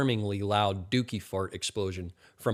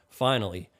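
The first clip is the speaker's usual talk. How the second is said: ordinary delivery, nothing marked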